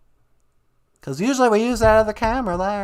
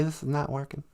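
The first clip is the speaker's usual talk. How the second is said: ordinary talk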